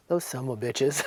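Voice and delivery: imitated comic curse, low-voiced; Elvis swearing. Elvis cursing the friends who set up the date, comic low imitation. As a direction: mutter his curse low, played for laughs. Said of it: "Like that".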